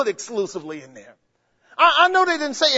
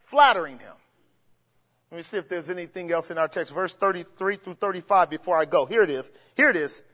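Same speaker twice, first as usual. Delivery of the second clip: usual speaking voice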